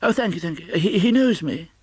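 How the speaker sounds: put-on high, eager old man at the door; flustered, hopeful plea; nervous Scrooge. Old Scrooge nervously asking the maid to let him in.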